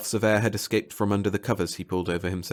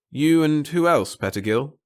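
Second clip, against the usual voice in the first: slow, louder challenge; lightly voiced challenge; 7 semitones higher, 6 dB louder than usual. Bartle challenges Pettigill, slow and pointed. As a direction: press him with a slow, pointed question.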